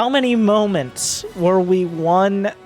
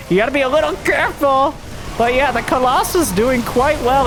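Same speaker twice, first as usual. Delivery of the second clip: excited; 9 semitones higher than usual. Real, not acted. Caster reacting to risky play.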